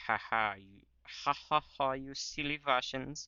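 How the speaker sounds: hammed-up German taunting with mock laughter; German accent. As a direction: gloat in a put-on German accent. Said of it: "I'm just doing a German accent".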